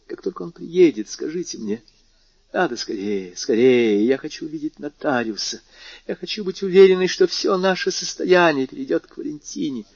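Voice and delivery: put-on high, urgent old woman; hurried feverish old lady. Marquise voiced in story.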